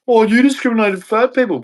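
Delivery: high-pitched parody of an accuser; mock complaint. Imitating someone complaining about discrimination, high mocking voice.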